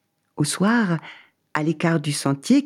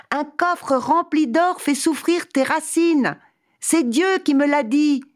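high voice calling across the plain; calling from afar; 11 semitones higher than usual. Acted. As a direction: call out from far away. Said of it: "Il lui cria de loin".

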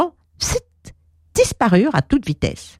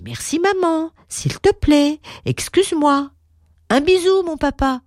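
put-on children's voices; 9 semitones higher than usual.

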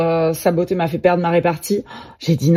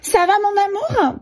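put-on very high girlfriend voice; high-pitched, sugary greeting to a lover; another woman arriving; 12 semitones higher than usual. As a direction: pipe up as the doting girlfriend. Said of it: "une nana arrive et dit".

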